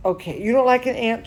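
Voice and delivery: ordinary delivery, nothing marked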